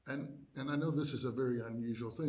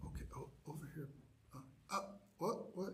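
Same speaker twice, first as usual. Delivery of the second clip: softer, rushed chaplain prompting; hushed fast prompting; 3 semitones higher, 10 dB quieter than usual. Retold or imitated. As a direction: nudge the confused bishop quietly, quickly. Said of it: "had to go up and go".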